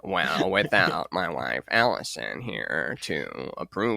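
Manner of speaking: low, slow, solemn mystic voice; Alex Grey impression. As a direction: drone it out like a serene guru. Alex Grey impression, wife named.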